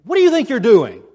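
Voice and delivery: loud, high demand; angry challenge. Acted. Owner of the house confronting disciples, angry loud challenge. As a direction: bark it like an owner catching thieves.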